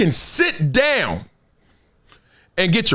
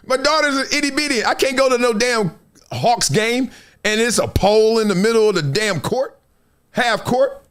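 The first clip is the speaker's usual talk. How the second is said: ordinary talk, nothing marked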